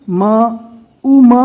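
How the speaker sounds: او م stretched very slowly; very slow demo. Shown as a demonstration. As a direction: draw out او م slowly.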